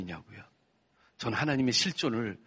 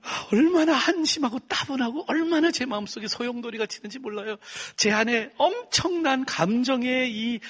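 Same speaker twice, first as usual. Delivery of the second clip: high, loud, pleading sermon voice; impassioned preaching; 11 semitones higher, 4 dB louder than usual. Acted. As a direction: pour out the childhood hurt to the crowd. Impassioned testimony, raised pitch. (높고 크게 터져 나오는 간증)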